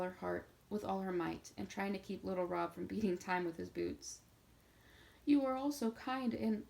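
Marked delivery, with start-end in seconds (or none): 5.2-6.6 sobbing. A narrator's reading. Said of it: "sobbed Nat".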